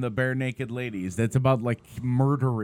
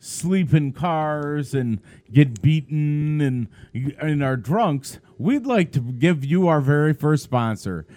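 loud, emphatic announcer plug; hammed-up ad read, projected hard; sponsor read; 6 dB louder than usual. Co-host segues from sad story to bar sponsor, loud announcer read.

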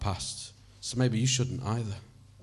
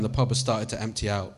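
ordinary delivery

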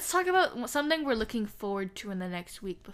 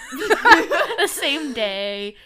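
laughing; 3 semitones higher, 12 dB louder, much slower than usual. Real, not acted. Laughing at the joke.

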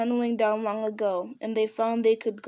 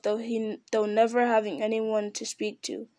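usual speaking voice

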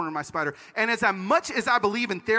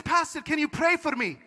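put-on higher-pitched, accented old woman; old lady; 6 semitones higher than usual. Old lady asking the pastor for prayer, high voice. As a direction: ask plaintively in a raised voice. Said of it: "one very old lady".

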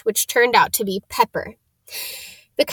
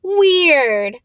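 amazed; 6 semitones higher, 4 dB louder than usual. Acted. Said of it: "Ron sounded amazed".

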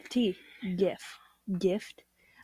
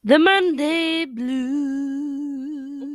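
stretched sing-song; 6 semitones higher, 3 dB louder, much slower than usual. Pretend. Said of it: "playing with the word Monday".

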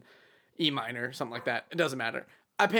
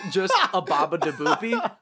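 a hammed-up Italian singsong, a little louder; mock Italian accent; 2 semitones higher, 4 dB louder than usual. Asked for his best accent, mock Italian singsong. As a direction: toss off a cartoon Italian catchphrase. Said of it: "What's your best accent?".

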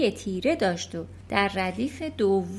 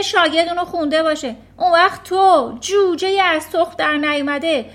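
much louder, higher furious yelling; shouting; 7 semitones higher, 11 dB louder than usual. Acted. Headmistress yelling at Matilda for lying, furious. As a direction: scream at the lying child. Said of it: "سرش فریاد کشید".

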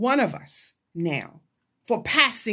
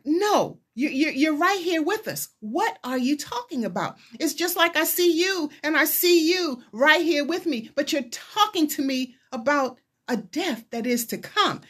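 higher-pitched, insistent acted protest; protesting disciples; 8 semitones higher than usual. Disciples objecting to their rabbi's talk of death, acted protest. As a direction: plead as a disbelieving follower, pitch raised. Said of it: "they're like".

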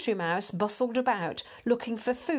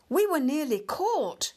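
higher-pitched fright; gasp-free but anxious, pitched up; alarmed mouse; 7 semitones higher than usual. A narrator's reading. Frightened mouse after narrow escape.

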